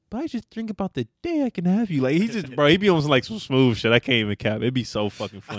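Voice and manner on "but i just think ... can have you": imitating Shoresy. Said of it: "He's like".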